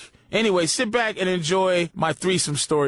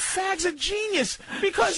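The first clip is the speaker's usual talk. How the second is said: high-pitched amazed exclamation; excited outburst; 11 semitones higher than usual. Real, not acted.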